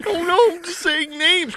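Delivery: high laughing voice; giggling, embarrassed squeal; squealing laugh-talk. Real, not acted. Laughing after name slip.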